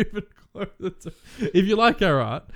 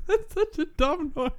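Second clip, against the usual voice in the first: laughing; 11 semitones higher, 4 dB quieter, slower than usual. Real, not acted. Laughing after roar.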